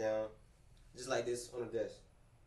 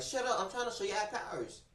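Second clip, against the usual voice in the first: snapping at grandma; 8 semitones higher than usual. Retold or imitated. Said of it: "then I just snapped".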